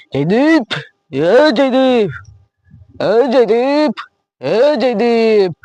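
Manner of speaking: slow drawn-out calls from far off; long hollering calls for Jaydeep; calling out. Acted. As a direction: stretch the name out, hollering for him. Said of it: "રાડું નાખશો".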